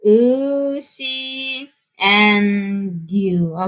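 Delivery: "Ushi and gyu" spelled out very slowly, each word drawn out; slow teaching demo of "Ushi" and "gyu"; slow pronunciation demo. Shown as a demonstration.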